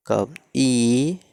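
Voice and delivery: कब ई stretched very slowly, ई held long; slowly sounded-out ई; slow vowel demo. Shown as a demonstration. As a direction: draw out ई slowly to show its sound. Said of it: "कब ई का उच्चारण".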